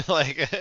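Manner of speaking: a short high burst of mocking laughter; sarcastic laugh. Real, not acted. Laughing at TSA memory.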